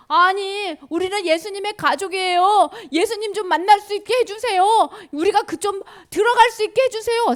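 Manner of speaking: 높고 크게 애원하는 목소리; pleading family. Acted. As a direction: beg loudly to be let in. 예수 가족이 들여보내 달라 조름. (높은 목소리로 간절히 조르기)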